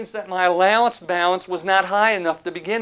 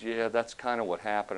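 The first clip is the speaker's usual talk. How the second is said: sheepish debtor; 7 semitones lower, 9 dB quieter than usual. Retold or imitated. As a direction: lower and softer admission. Debtor admits story.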